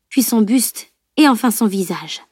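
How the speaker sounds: ordinary delivery, nothing marked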